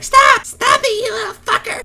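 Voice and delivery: put-on very high, loud squeaky kid yell; fourth-grader voice. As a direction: imitate your little-kid self shrieking in anger. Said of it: "it probably sounded like".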